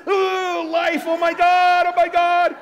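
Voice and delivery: high, loud mock panic, slowed; hammed-up terrified wail; panicked shriek. Shown as a demonstration. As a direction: shriek like the world is ending. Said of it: "Are you going like this?".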